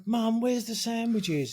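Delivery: put-on high, slow little kid voice calling mum; child calling out. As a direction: call out like a puzzled small kid.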